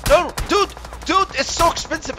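high, loud plea; mock-distressed outcry; alarmed protest. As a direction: yell at them to spare your car. Car is being shot at.

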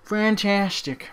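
loud, high, slow sarcastic "fantastic". Real, not acted. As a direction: drag it out in mock delight. Teammates stacked on enemy team.

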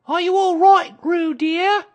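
high, loud anxious call; worried mother calling out; Kanga. A narrator's reading. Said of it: "called Kanga anxiously".

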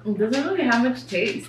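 talking between bites; chewing while talking. Real, not acted. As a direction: mouth partly full of burger. Seen 0: Man holds a bitten burger; cheeks and lips show chewing while he speaks.

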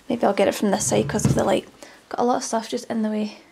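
usual speaking voice

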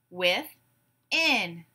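"Within" in two drawn-out beats; syllable by syllable. Shown as a demonstration. Class breaks the word apart together, syllable by syllable. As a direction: say "Within" as with, pause, in. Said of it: "break it apart into syllables".

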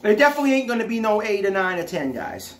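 chewing while talking; speaking through food; mouth full of chips. Real, not acted. Seen 4: Left man bites chip at -1.0, hand at mouth, then speaks chewing.

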